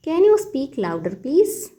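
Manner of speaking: very slow, teacherly model phrase; slow model sentence. Shown as a demonstration. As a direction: spell it out slowly for learners. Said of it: "तो इस बात को कहिए". (बहुत धीमे, सिखाने वाले अंदाज़ में)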